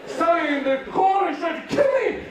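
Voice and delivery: hammed-up chicken in disbelief; indignant chicken. Voices the traumatized chicken's thoughts.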